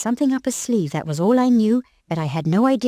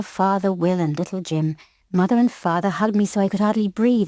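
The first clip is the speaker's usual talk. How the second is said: plain reading voice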